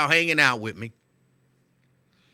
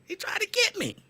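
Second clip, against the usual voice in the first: very high astonished repeat; higher disbelief. Real, not acted. To viewers, still stunned the clerk tried to overcharge him, much higher. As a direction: say it again, even more stunned.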